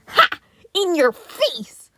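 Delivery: loud, slow, high taunt; gloating yell. A narrator's reading. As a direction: shout it at your twin. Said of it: "Donnie yelled".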